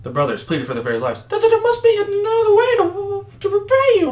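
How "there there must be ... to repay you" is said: loud, very high cartoon voice; Mugman stammering. Brother pleads with the devil for his life, squeaky stammer. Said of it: "Mugman stammered".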